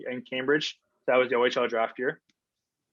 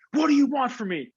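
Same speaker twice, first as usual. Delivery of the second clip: high, loud mock yell at coach; retold exasperated outburst; 10 semitones higher, 5 dB louder than usual. Player confronts coach who keeps yelling, retold exasperation. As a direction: re-enact being fed up, pitch jumping up.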